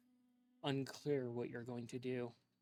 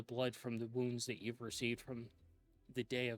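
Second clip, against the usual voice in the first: dark fantasy narration, unhurried; game master scene setting. Acted. Game master sets a grim scene.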